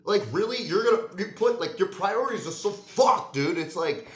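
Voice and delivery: loud and high, exasperated; yelling at lazy people; angry rant. Real, not acted.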